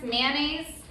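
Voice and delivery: usual speaking voice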